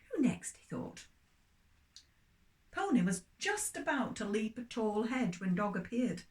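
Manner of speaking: lively storybook reading for little ones; story reading for children. Acted. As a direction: tell it like a bedtime story to children.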